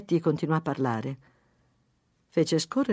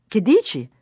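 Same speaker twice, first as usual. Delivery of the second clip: raised, higher questioning voice; calling out; 5 semitones higher, 8 dB louder than usual. A narrator's reading. Wife in kitchen to husband, calling out. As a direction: call through for an answer.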